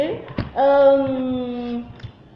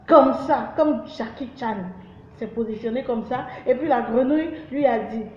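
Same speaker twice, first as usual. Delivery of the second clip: usual speaking voice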